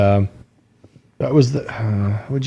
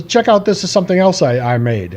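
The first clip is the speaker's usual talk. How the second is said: mock proud show-off; 7 semitones higher than usual. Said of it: "he goes".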